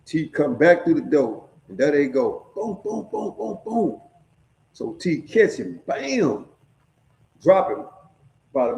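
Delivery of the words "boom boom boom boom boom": punchy boom noises; fight sound effects. Retold or imitated. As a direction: imitate the punches landing.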